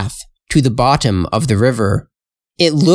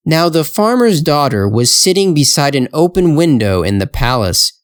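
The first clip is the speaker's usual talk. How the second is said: ordinary delivery, nothing marked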